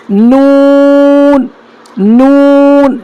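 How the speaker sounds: نون held very long; slow model of نون; drawn-out letter demo. Shown as a demonstration. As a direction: stretch نون slowly. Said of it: "یہ آسان ہے".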